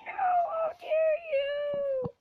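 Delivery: very high, slow, loud cry; hammed-up high wail of offence; mock-outraged wail. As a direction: stretch the outrage out.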